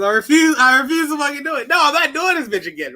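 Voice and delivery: high, fast, near-crying refusal; stubborn refusal. Retold or imitated. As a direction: whine it out like you're begging to get out of it. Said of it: "He goes".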